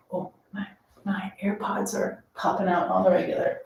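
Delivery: hushed complaint under the breath; whispered aside. Real, not acted. Muttered aside about her earbuds.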